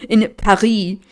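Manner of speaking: Paris in a French accent, silent S. Shown as a demonstration. Reader correcting their slip, French accent on "Paris". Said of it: "switched from a French accent".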